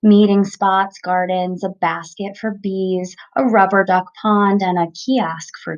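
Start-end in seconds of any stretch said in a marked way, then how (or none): none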